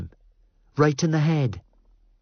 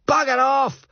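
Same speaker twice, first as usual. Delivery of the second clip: much higher, raging shout across the beach; furious yell. Acted. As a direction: bellow his name like a drunk madman. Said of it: "Fraser yells".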